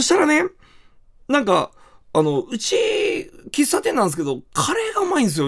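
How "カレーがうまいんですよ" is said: cafe owner boast. Retold or imitated. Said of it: "って言われて".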